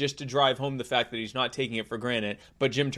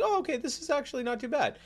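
pleasantly surprised; 12 semitones higher, 4 dB quieter than usual. Retold or imitated. Acted surprised taster.

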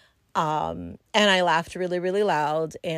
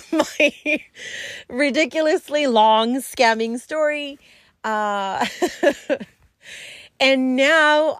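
laughing, higher and louder; 7 semitones higher, 6 dB louder, slower than usual. Real, not acted. Closing her story for viewers, laughing. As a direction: giggle as you wrap up.

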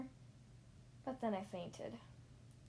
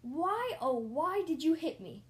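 loud, high, drawn-out lament; melodramatic, swooping protest; anguished outcry; 6 semitones higher, 7 dB louder, slower than usual. A narrator's reading. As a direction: wail the question dramatically. Outraged lament at the driver.

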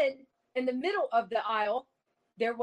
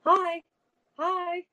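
spirit box man; 7 semitones higher than usual. Retold or imitated. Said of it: "hearing this man coming through the spirit box".

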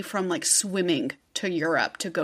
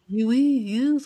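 put-on higher, jokey French accent; mock French accent; 4 semitones higher, 3 dB louder than usual. Imitating the supposed Texan kid, mock French accent. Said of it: "he also has a French accent".

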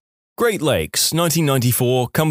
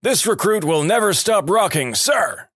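high-pitched reply without real volume; mock recruit yell; 7 semitones higher than usual. A narrator's reading. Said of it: "To which Law yelled".

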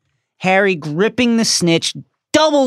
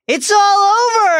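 much higher, loud far-off commentator shout; announcer's call; 14 semitones higher, 4 dB louder than usual. Acted. Commentator calls the match end.

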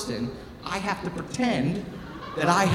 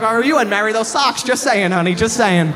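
loud, raised-pitch needling; mock threat; 4 semitones higher, 8 dB louder than usual. Husband needling his wife over laundry, loud mock threat. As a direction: tease her with a loud mock warning.

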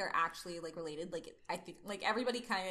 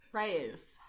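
loud, hammy cowboy drawl; a Southern cowboy, half laughing; bad Southern accent; 4 dB louder than usual. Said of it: "do the bad Southern accent, be McCree".

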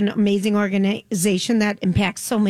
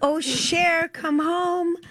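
high, soft mock call from the stage; 6 semitones higher, 3 dB quieter, much slower than usual. Host voicing the stage calling [name] home, high and soft. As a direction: coo like the stage is beckoning her home. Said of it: "is saying the wood on the stage".

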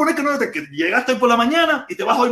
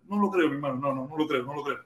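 fading out; 5 semitones lower, 9 dB quieter, faster than usual. Real, not acted. Fading doubtful repetition.

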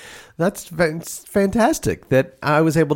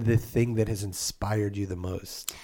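hushed; 4 semitones lower, 7 dB quieter than usual. Acted. Awed fan question, hushed.

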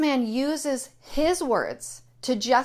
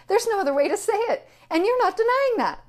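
incredulous laugh; 9 semitones higher than usual. Real, not acted. Ranting about the driver, an incredulous laugh. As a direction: laugh it out, outraged and amazed.

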